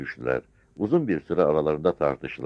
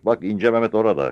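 raised, higher pointing-out cry; urgent calling out; 6 semitones higher, 6 dB louder than usual. A narrator's reading. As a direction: call it across to the officer. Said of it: "diye bağırdı Sarı Çavuş". (yüksek sesle haber vererek)

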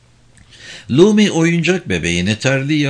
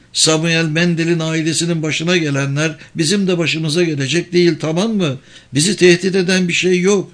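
higher-pitched, slightly heated denial; agitated woman, insisting; 6 semitones higher than usual. A narrator's reading. Woman tells driver there is no threat, insistent. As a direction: insist in a raised, tense voice. Narrator voices upset woman.

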